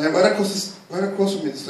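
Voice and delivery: ordinary talk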